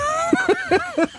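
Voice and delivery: high-pitched burst of laughter. Real, not acted. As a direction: let a shrill laugh escape. Laughing at Russian joke.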